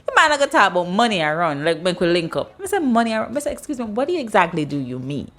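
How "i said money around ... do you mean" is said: ordinary talk, nothing marked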